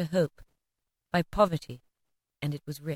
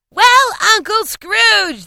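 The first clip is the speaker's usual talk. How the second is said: high, loud, slow cheering call; crowd toast; 11 dB louder, much slower than usual. A narrator's reading. Guests crying out a toast, high and loud. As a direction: cheer it out together. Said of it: "they cried".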